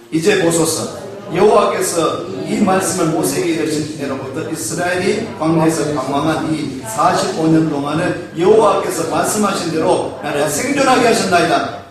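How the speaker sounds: normal talking voice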